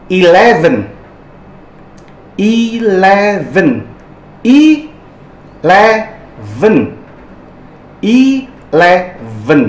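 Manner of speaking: Eleven stretched slowly, clear v sound; slow model pronunciation. Shown as a demonstration. Teacher stresses the v in Eleven, slowly. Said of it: "ingat itu V".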